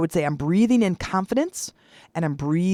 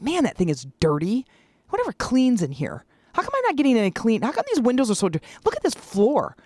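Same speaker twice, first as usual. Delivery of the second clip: higher, fast, rising irritation; retold mad rant; 6 semitones higher than usual. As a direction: rattle off complaints getting more annoyed. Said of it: "now I'm mad".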